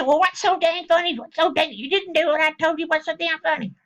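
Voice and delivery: high, exasperated imitation of the wife; mock yelling wife. Wife demanding why he's grinning, mock yelling. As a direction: play the wife fed up and yelling, pitched well up. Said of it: "was yelling and screaming".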